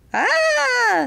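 long, loud high-pitched worm scream. A narrator's reading. Worm sees a bee arrive, loud high scream. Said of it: "screamed a worm".

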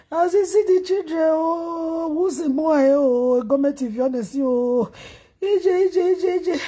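long, drawn-out crying words; real wailing lament. Real, not acted. Mourner crying out over the children left behind, wailing. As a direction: wail it out, stretching each phrase end.

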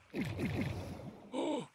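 loud startled gasp of fright; real shocked gasp; sharp gasp. Real, not acted. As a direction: gasp in alarm. Near loss of dog.

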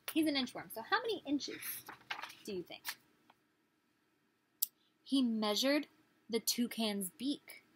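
usual speaking voice

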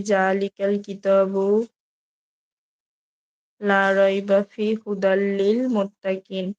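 slow, measured Quranic recitation. Acted. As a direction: draw each Arabic syllable out slowly. Reads Quran verses aloud. (ধীর কোরআন তিলাওয়াত)

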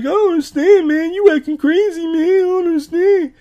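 very high, loud mock whine of confusion; hammed-up squeaky imitation of a defensive dude; high-pitched funny voice. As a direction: squeal it like a cornered friend playing innocent. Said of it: "a funny voice in this episode".

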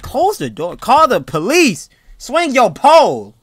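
high, loud, drawn-out orders; alarmed, slow high yell; urgent yelling. Real, not acted. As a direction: stretch each command into a shout. Urgent orders yelled at character.

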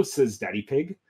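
plain reading voice

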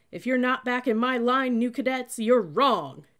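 very loud, high military threat; shouted warning. A narrator's reading. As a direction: bellow it at the stragglers. Cadet shouting threat at recruits.

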